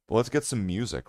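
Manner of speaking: ordinary delivery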